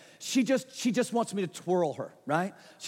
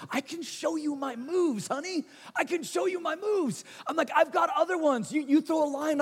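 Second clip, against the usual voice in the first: higher, pumped-up bragging about his moves; eager dad showing off; 7 semitones higher than usual. Retold or imitated. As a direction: sell your dance moves to your little girl.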